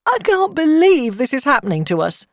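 high, loud, tearful disbelief; distraught disbelief. A narrator's reading. Accused boy's mother to her friend, distraught. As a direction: cry it out in anguish.

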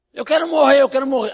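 high, loud despairing cry; acting out a man crying out to die; imitated wail. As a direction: wail it up to the window. Retold Graciano wailing at window.

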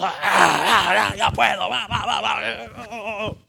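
high, loud, excited bursts of ah and va; laughing, straining to go; eager impatient cry. Acted. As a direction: push forward like someone who can't wait. Acts out impatient eager person.

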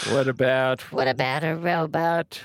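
processed robot effect voice. Shown as a demonstration. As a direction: test the robot filter. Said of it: "does this sound any different".

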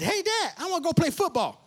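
put-on high eager little-kid voice; kid asking dad. Boy asking his dad to play football, high and eager.

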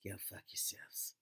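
slow unvoiced whisper; whispered retort. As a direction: mutter it under your breath, slowly. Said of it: "And you're just going".

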